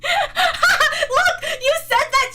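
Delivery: loud, high, laughing protest; laughing as you call them out. Real, not acted. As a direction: burst out laughing into the words. Laughing at chat reactions.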